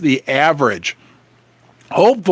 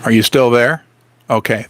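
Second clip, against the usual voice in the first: normal talking voice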